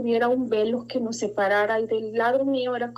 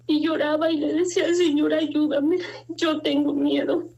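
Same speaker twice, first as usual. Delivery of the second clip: real crying creeping into a higher voice; pleading, choked up and higher; tearful; 4 semitones higher than usual. Real, not acted. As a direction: relive the guilt, close to tears. Breaks up recalling guilt, fear, weeping.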